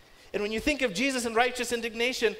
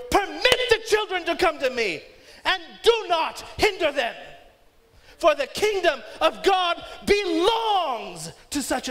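put-on higher, louder, furious Permit the children; indignant; 7 semitones higher, 4 dB louder than usual. Shown as a demonstration. Jesus indignant at his disciples, loud furious rebuke. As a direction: rebuke them with fury. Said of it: "These words were not a simple, quiet".

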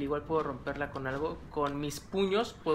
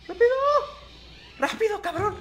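much higher, louder laughing shout; laughing panic; 6 dB louder than usual. Real, not acted. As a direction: hurry it up laughing. Apura la puerta riendo. (grito apurado entre risas)

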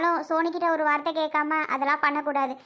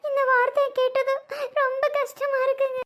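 high-pitched crying voice; tearful hurt; 8 semitones higher than usual. Acted. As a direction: sob out how much it hurts. Hurt woman crying, told not to. (அழுதுகிட்டே வருத்தமா சொல்வது)

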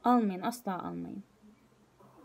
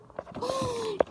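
a sudden high gasp before crying; sharp high gasp. Real, not acted. Over her ruined laptop, a high tearful gasp. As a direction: gasp as the tears start.

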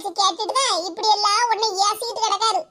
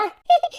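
brief high chuckle; gloating giggle; 5 semitones higher than usual. Acted. [name] asked what she means, gloating giggle. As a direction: chuckle smugly before answering. Gloating before explaining her claim.

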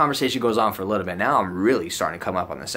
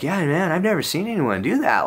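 lightly voiced higher dude voice; higher-pitched mock amazement; amazed roommate; 8 semitones higher than usual. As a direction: imitate a mildly astonished stoner buddy.

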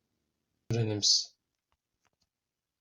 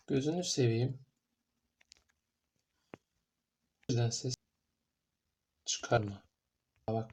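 hushed, hiding from Granny; whispering while hiding; 3 semitones lower, 8 dB quieter than usual. Real, not acted. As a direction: keep it low so she won't hear.

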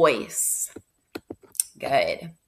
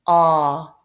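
usual speaking voice

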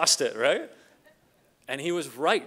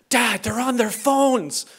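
put-on slow, higher and louder, an upset eleven-year-old; upset kid; 6 semitones higher, 8 dB louder, slower than usual. Son complaining to his dad at brunch, upset kid voice. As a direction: play the blunt little boy tugging at dad. Son's voice in a retold story.

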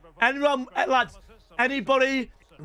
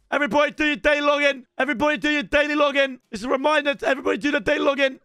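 fast, higher-pitched announcement; rapid-fire reminder; 4 semitones higher, much faster than usual. Real, not acted. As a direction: rattle it off insistently at the chat.

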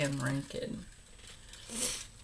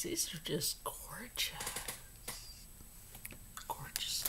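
hushed whisper of delight; awed whisper; 2 semitones lower, 15 dB quieter than usual. Real, not acted. Admiring new earrings for her viewers, whispered.

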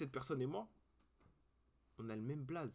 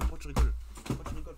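shouted, much louder, teasing; sudden loud yell; 2 semitones higher, 11 dB louder, faster than usual. Real, not acted. Yelling at a friend who keeps laughing, loud.

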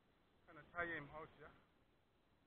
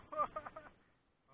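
excited laugh; 13 semitones higher, 12 dB louder than usual. Real, not acted. Laughing while fighting fish.

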